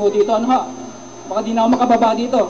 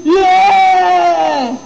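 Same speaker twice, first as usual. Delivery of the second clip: loud, higher drawn-out yeah; mock cheer; 4 semitones higher, 5 dB louder than usual. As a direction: cheer like a whole crowd. Buddhists cheering in joke. (malakas na hiyawan ng tao)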